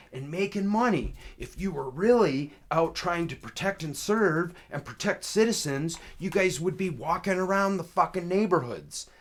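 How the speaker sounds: high, loud retold tirade; angry retort. Caller accuses officer of just making money, angry. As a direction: lay into the officer, punching the accusations.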